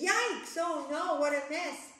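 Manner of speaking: high, loud yelp easing down; storybook witch startled by a mess; alarmed dismay. Acted. Witch reacts to spilled mess.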